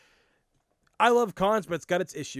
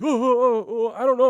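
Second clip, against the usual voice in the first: higher and louder, stammering and caught out; mock the creep fumbling for words, higher and louder; flustered photographer; 7 semitones higher, 9 dB louder than usual.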